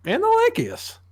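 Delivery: sudden high-pitched squeak. Real, not acted. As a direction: squeak the last word up high. Self-introduction pitch jump.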